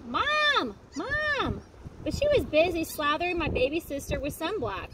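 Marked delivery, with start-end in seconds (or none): 0.0-1.6 high calling out to Mom; child calling for mom, up high. Retold or imitated. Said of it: "I tried to tell Mom".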